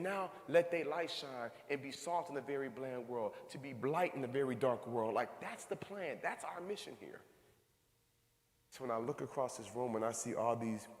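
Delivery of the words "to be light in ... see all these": normal talking voice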